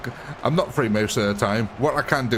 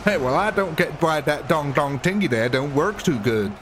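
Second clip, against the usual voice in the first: put-on southern accent; 3 semitones higher than usual. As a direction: play a folksy Southerner with a thick accent. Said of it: "it just immediately goes south".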